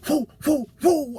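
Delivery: high, light whoops; mild whooping. Retold or imitated. Trucker mimics passing rigs with light whoops.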